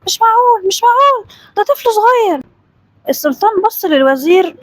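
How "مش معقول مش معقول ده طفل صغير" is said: high-pitched indignant protest; angry disbelief. A narrator's reading. Vizier reacts to a child being the scholar, angry. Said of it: "وقال بغضب".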